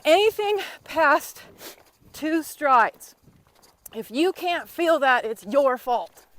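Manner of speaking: loud, slow, drawn-out coaching call; a loud, slow coach's yell. Real, not acted. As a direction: call it out across the arena to the riders. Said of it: "I'm sorry I'm yelling at you kids".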